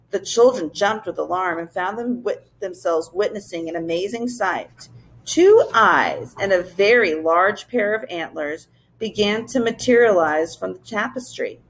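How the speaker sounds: ordinary delivery, nothing marked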